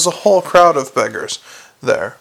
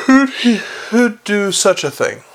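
distressed question; 5 semitones higher than usual. A narrator's reading. Angwa asking who would kill, in high distress. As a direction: ask in appalled, raised pitch. Narrator voices dismay at a killing.

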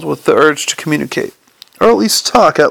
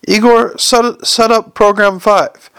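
calling out; 6 semitones higher, slower than usual. Acted. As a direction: call to the assistant in the glassware. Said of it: "Hubert shouted to some figure in the forest of glassware".

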